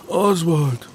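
high, tearful name; sobbed. A narrator's reading. Boy answers the roll call, sobbing. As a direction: say your own name through sobs. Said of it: "er schluchzte".

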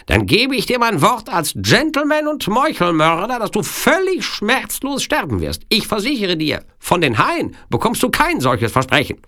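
very high-pitched villain giving his solemn word; bargaining Sicilian villain. Acted. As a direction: promise and threaten in a piercingly high voice. (feierlich drohender Sizilianer, sehr hohe Stimme)

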